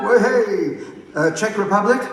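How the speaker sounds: high, rousing roll-call voice. Real, not acted. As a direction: cheer along, then call the name out. Cheers, then calls next country.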